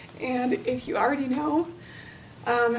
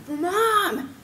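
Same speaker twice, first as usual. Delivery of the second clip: mild mock yell, a little higher; retold yell; 5 semitones higher than usual. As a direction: call out for mom, lightly raised. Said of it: "he just yelled really loud".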